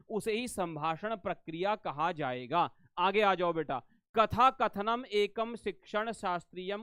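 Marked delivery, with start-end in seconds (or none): none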